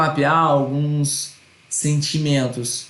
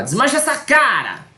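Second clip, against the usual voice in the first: high, sharp scolding mother voice; 9 semitones higher than usual. Retold or imitated. Mother telling her angry child to fix his face, scolding high. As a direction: snap at the kid like a nagging mom. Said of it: "e aí a mãe fala".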